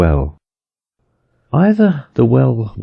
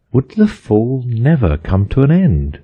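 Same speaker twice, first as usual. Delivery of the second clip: ordinary delivery, nothing marked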